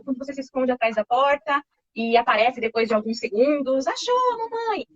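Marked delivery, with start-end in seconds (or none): 3.9-4.8 high, bright sing-song peekaboo call; playful peekaboo. Shown as a demonstration. Mother revealing herself to her baby, high playful call. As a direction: pop out and delight the baby.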